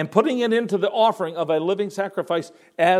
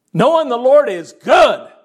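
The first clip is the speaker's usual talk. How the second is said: ringing proclamation; 7 semitones higher, 8 dB louder, slower than usual. Real, not acted. Preacher rising to a cry.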